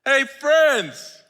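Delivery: loud, high Friends; Jesus hailing his friends; loud fond call. Acted. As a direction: call it out with affection. Preacher voicing Jesus.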